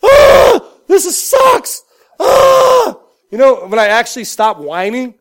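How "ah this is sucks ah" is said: high, loud whiny wailing; whiny sissy baby. Retold or imitated. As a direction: wail like a big baby. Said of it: "like a whiny sissy baby".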